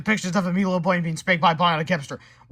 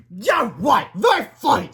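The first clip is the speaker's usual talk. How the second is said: high, loud sarcastic shout; angry sarcasm; 6 semitones higher, 7 dB louder than usual. A narrator's reading. Principal to the laughing woman, angrily sarcastic. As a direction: throw it back loudly, unamused.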